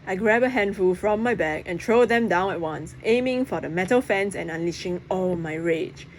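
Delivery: ordinary delivery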